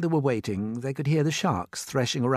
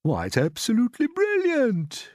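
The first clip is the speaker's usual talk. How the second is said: high, loud exclamation of admiration; delighted cry; 13 semitones higher, 5 dB louder than usual. A narrator's reading. Old grasshopper praising James's plan, crying out. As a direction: hail the plan loudly. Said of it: "cried the old".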